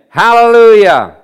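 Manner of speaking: preacher's full-voiced hallelujah; exclaimed praise. Real, not acted. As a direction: belt out a praise shout to the congregation.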